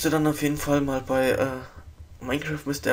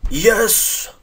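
loud high yes of triumph; cheering; 6 semitones higher, 9 dB louder than usual. Real, not acted. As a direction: celebrate the win out loud. Cheering a won fight.

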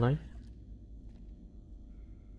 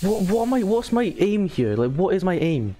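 exasperated; 9 semitones higher, 4 dB louder than usual. Real, not acted. Frustrated rant.

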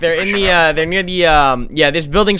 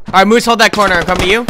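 shouting; 4 semitones higher, 6 dB louder, faster than usual. Real, not acted. Moving to teammate.

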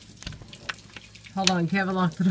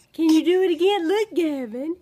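high, louder eager coaxing; 7 semitones higher, 5 dB louder than usual. Real, not acted. Coaxing baby to repeat.

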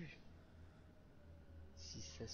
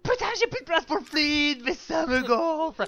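high, loud, slow annoyed complaint; 12 semitones higher, 7 dB louder than usual. Real, not acted. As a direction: vent the frustration. Frustrated with inventory space.

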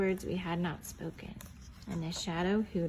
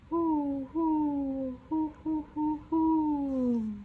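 answering owl hoot; 6 semitones higher, much slower than usual. Acted. The owl answering Pa through the trees, hooting. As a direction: hoot back as the distant owl. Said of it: "an echo came threading its way".

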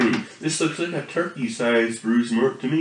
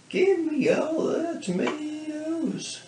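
high silly voice; 12 semitones higher, 4 dB quieter, slower than usual. Pretend.

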